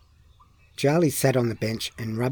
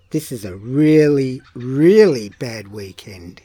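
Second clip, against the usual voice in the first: louder, slow stress on "really, really"; emphatic complaint; 7 dB louder than usual. A narrator's reading. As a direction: stretch both "really" with dismay. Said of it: "he thought".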